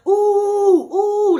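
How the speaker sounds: high, loud ooh; brother oohing. Retold or imitated. Brother cheering the fight, oohing high. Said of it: "You know, my brother".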